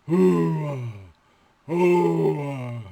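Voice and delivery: loud mock cattle bellow. Shown as a demonstration. Teacher imitating a bull for vocabulary, loud bellow.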